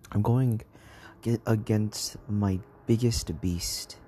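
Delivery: normal talking voice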